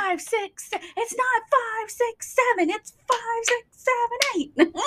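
high, soft dance count; Debbie Allen counting. Pretend. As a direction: count the steps like a dance teacher. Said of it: "I feel like Debbie Allen".

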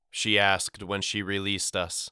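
plain reading voice

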